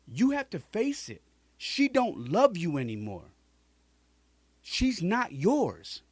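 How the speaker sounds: high, loud, pleading lecture; impassioned shouting. Retold or imitated. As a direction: shout it out of love.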